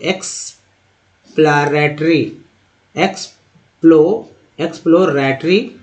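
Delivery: "एक्सप्लोरेटरी" slowly in pieces; drawn-out teaching read of "एक्सप्लोरेटरी"; model pronunciation. Shown as a demonstration. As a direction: break "एक्सप्लोरेटरी" into eks, plo, ra-tree. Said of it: "देखिए".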